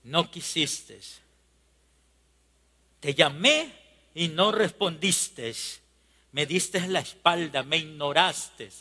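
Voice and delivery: hushed, low reproach with long pauses; Dios reproaching. Acted. God to those who ignored him, quiet low reproach. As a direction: drop to a soft, grieved rebuke. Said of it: "Y el Señor dice".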